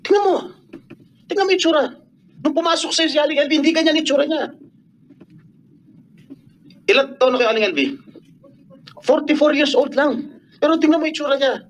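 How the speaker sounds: high-pitched, indignant appeal. Real, not acted. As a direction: demand they look, pitch pushed up in outrage. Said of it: "tumataas ang boses ko".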